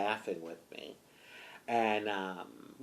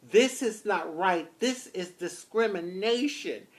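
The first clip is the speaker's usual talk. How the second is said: high, emphatic retold objection; upset protest; 8 semitones higher, 3 dB louder than usual. Driver telling the officers off, upset. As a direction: protest it firmly, pitched up. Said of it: "I was really upset".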